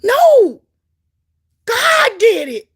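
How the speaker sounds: very high, loud, slow shout; shouted proclamation. Real, not acted. Preacher shouting to the crowd that God did it. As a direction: proclaim it at full force.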